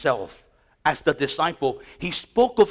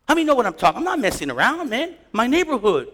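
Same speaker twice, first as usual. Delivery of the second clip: normal talking voice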